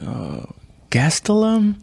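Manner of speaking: hesitant, high questioning guess; unsure guess. Retold or imitated. Answering friends' question, high and unsure. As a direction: guess the name, rising high. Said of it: "I'll be like".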